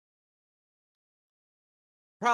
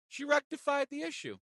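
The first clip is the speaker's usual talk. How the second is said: "rectified" said much higher, sing-song; 11 semitones higher than usual. Shown as a demonstration. Teller repeating the good news in a high sing-song.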